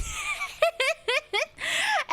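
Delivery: very high, helpless giggle; real squealing laugh. Real, not acted. Streamer amused by women swooning over Robert, real squealing laugh.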